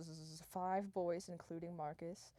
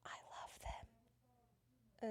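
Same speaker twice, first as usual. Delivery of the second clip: slow, dramatic whisper; whispered confession; 7 dB quieter, much slower than usual. Acted. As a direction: drop to a drawn-out whisper. Acted dramatic confession after denial.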